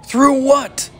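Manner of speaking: put-on a startled old man calling out; mild startled shout; shouted question. A narrator's reading. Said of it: "shouted Grandpa Joe".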